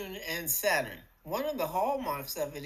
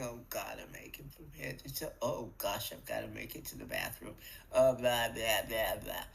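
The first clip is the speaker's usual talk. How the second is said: groaning complaint; 9 semitones lower than usual. Pretend. Waking up aching, moaning to yourself in a low grumble. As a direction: drag yourself through a groaning morning whine. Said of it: "rose up saying".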